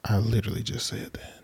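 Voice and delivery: hushed, disbelieving retort; exasperated whisper. Real, not acted. Exasperated at being asked again.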